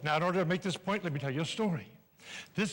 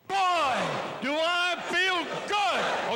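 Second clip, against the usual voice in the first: big, slow, high burst of joy; hearty exclamation; 10 semitones higher, much slower than usual. Acted. Showman opening to the audience, big slow joyful shout. Speaker revs up the crowd.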